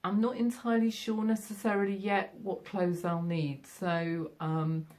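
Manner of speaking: normal talking voice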